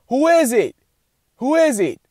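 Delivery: high, loud call toward the door; startled call. Retold or imitated. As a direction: shout it out, startled.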